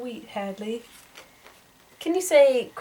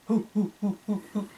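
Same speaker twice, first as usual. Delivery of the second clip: short, separate monkey hoots; 2 semitones lower, 6 dB quieter, faster than usual. Shown as a demonstration. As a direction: hoot lightly like a monkey.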